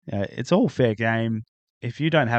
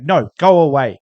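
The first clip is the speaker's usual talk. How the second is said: put-on shooing kids away; 3 semitones higher, 8 dB louder than usual. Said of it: "she's like".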